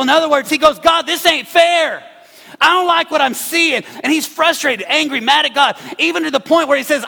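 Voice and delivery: loud, high preacher build; preaching at full force. Acted. Pastor voicing David's complaint to the church, loud preaching. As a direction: drive David's complaint home. Preaching Psalm seventy-three.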